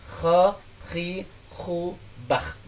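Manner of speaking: "Kha, khi, khu" as a gentler scrape, trailing quiet. Shown as a demonstration. Teacher shows a gentler kha, soft scrape. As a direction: model a smoother kha. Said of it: "cuman dengan lebih halus".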